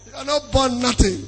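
normal talking voice

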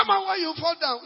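loud, high mocking scold; preacher mimics a parent scolding a fallen child, loud. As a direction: scold the fallen one, hammed up.

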